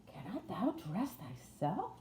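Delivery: slow, incredulous Yorkshire question; amazed. A narrator's reading. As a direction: draw the question out in astonishment. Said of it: "Martha uttered in amazement".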